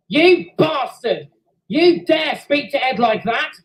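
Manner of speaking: furious, high and loud shouting; enraged engine shouting at the rival in the pit. A narrator's reading. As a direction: roar threats at the bully. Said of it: "Sid was enraged".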